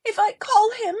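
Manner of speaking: high, loud shuddering cry; terrified girl trembling; shuddering terror. Acted. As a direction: shudder through chattering teeth. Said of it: "shuddered Much Afraid through chattering teeth".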